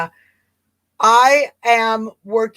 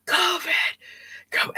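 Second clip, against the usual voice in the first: creepy mock whisper, softer; whispery voice; 9 semitones higher, 7 dB quieter than usual. Imitating what the prank caller was doing, creepy whisper. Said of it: "This is what he's doing".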